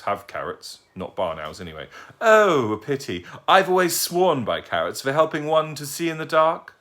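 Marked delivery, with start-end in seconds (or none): 2.2-6.7 put-on a dotty, courteous old lady; polite old lady. Old lady greeting the owl who fell at her feet, genteel and high.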